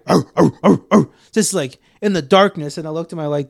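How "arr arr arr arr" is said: short, punchy barking noises; mimicked feral dog barking; imitated dog barks. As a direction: bark like dogs in the dark. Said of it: "And then I hear".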